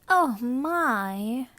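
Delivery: mock dismay. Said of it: "she said with mock dismay".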